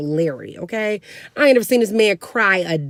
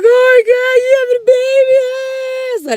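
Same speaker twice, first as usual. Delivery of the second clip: very loud, very high-pitched wail; hysterical sister imitation; 9 dB louder than usual. Sister reacting to the pregnancy news, mock hysterical shriek. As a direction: shriek the question, stretching into a scream.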